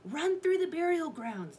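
high, loud urging; urgent order. A narrator's reading. Scholar urges children to flee.

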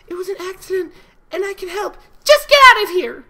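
Fluttershy pleading, growing desperate and shrill; rising falsetto. Acted. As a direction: let the plea break up into falsetto. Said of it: "Fluttershy's voice grew more and more falsetto".

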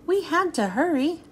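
plain reading voice

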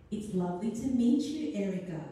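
put-on bright, friendly nun greeting a child; sweet to a child. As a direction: coo it kindly, as to a little girl.

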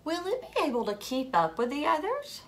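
plain reading voice

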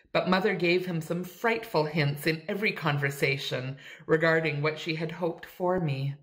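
ordinary delivery, nothing marked

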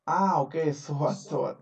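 ordinary talk, nothing marked

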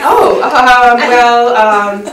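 loud, hesitant mock dismay; flustered mom. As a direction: imitate a mom awkwardly at a loss for words. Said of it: "my mom would be like".

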